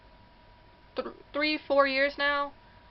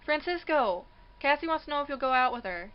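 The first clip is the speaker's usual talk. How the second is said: mock yell; 4 semitones higher than usual. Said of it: "his sister yells out".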